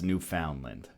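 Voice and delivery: "Newfoundland" as new-FOUND-lind; non-local pronunciation. Shown as a demonstration. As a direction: give "Newfoundland" a full "found" in the middle. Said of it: "I've never heard it pronounced".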